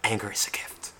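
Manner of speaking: hushed whisper into the microphone. Retold or imitated. Imitating a rock singer's lone line when the music stops, whispered. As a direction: whisper it close to the mic. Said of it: "you just hear him whisper into the microphone".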